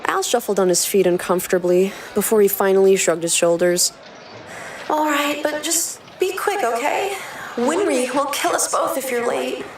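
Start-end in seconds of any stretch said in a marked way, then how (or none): 4.9-9.6 higher, soft worried younger brother. Acted. Narrator voicing Al warning his brother, high and worried. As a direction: plead gently, a little anxious.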